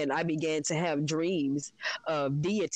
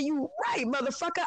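retold retort, pitched much higher; sassy retort; 11 semitones higher than usual. Retelling how she finally answered her husband, mocking. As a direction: throw it back at him, pitched way up. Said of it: "I said".

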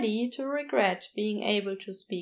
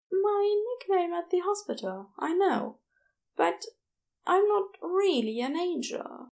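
higher, gentle, hesitant angel voice; Aziraphale's voice; 7 semitones higher than usual. A narrator's reading. Shy nurse explaining his nickname to the florist, higher and hesitant.

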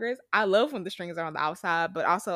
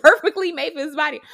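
normal talking voice

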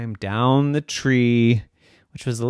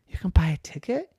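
whisper; 5 semitones higher than usual. Acted. Boy amazed whisper.

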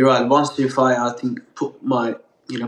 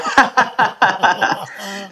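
high giggle; 8 semitones higher than usual. Real, not acted. Guest laughs at his own joke, a high giggle. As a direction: burst of high, giddy laughter.

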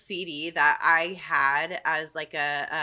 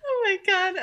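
giggly; 11 semitones higher, faster than usual. Real, not acted. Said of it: "I have the giggles so bad".

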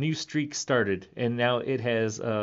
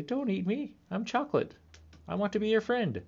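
talking candy; 10 semitones higher than usual. Pretend. Chocolate candy begging not to be eaten, tiny high plea. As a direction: beg sweetly like a little chocolate. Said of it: "They would say".

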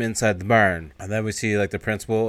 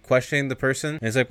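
ordinary delivery

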